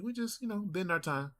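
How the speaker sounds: very high, easygoing uncle impression; high-pitched, unbothered uncle voice; uncle's tone. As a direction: imitate your uncle taking it in stride. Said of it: "That's how he talks".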